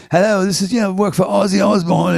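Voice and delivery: a light imitation of a British road manager; British accent. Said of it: "someone else with a British accent saying".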